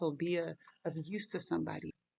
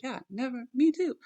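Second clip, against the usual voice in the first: higher, louder eager vow in a lightly voiced disciple; mock eager disciple; 8 semitones higher, 5 dB louder than usual. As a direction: play the eager disciple jumping in. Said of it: "To just kinda be like".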